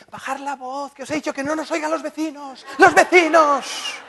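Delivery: high, hushed then loud panicked mother; mother's voice. Retold or imitated. Son imitating his mother hushing the kids about the neighbours. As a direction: mimic mom shushing about the neighbours. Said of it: "¿sabéis lo que nos dice?".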